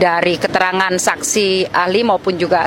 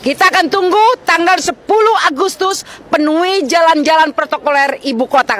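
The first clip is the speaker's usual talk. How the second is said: fiery rally speech; 6 semitones higher than usual. Real, not acted. Rallying workers.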